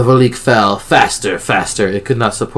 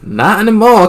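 high, loud southern twang; excited southern twang; 11 semitones higher, 6 dB louder than usual. A narrator's reading. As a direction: answer brightly in a southern twang. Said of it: "Cassie's little southern twang".

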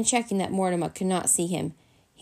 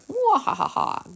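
high, booming cartoon laugh; theatrical wahaha laugh; hearty guffaw; 7 semitones higher, 3 dB louder than usual. A narrator's reading. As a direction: laugh big at your own joke. Grandpa laughs at his own joke.

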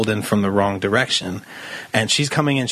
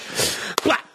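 voiceless, softly puffed "splat"; near-whispered sound effect; imitated splat; 4 dB quieter than usual. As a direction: make a small breath-only impact sound. Imitated impact, first softer take.

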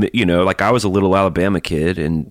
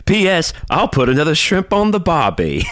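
slow, high, hammy Aussie; drawn-out bogan Australian; mock Australian accent; 8 semitones higher than usual. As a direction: do a cartoon Australian catchphrase. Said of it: "We sound like a couple bogans".

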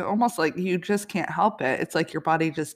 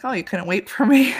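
teasing doctor; 5 semitones higher, 8 dB louder than usual. Retold or imitated. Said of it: "she's like".

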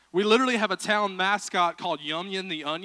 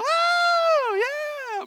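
high, loud drawn-out whoop; mock crowd cheer; 7 dB louder than usual. Preacher imitating Target fans cheering, high mock whoop. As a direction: whoop it up like a thrilled fan. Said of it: "Target, you're like".

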